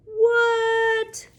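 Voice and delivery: high, loud mock-astonished 'what'; mock surprise. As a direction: play it up as if amazed for the child. Mock amazement at dinosaur eggs.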